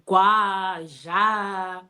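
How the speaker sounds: gwa and ja with long, open, drawn-out "a"; "gwa, ja" sounded out with full, held Shona vowels; bold Shona vowels. Shown as a demonstration. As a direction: stretch the vowels in "gwa" and "ja" boldly. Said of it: "very bold Shona vowels".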